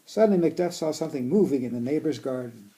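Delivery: storybook reading voice; reading to children. Acted. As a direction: read it aloud to kids. Reading picture book aloud.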